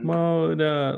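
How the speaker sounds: put-on "Mona", high, said like a little toddler calling out.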